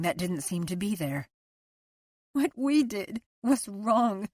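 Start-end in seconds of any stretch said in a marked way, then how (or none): 2.3-4.3 slow, heavy remorse; tearful remorse. A narrator's reading. Liz searching husband's eyes, slow and tearful. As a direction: press the guilt slowly.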